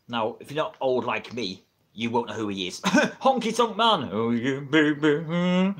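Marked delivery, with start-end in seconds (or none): 2.8-5.7 drawn-out, hammed-up wrestler impression; playful, stretched-out catchphrase; Honky Tonk Man. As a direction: croon it slowly like a showman. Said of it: "Honky Tonk Man impression there".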